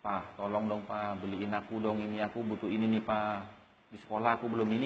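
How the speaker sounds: low, soft coaxing request; child asking father privately. Acted. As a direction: ask Dad quietly, keeping it between you. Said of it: "Kalau di hadapan Pak Dadang sendiri". (anak memohon pelan kepada bapaknya)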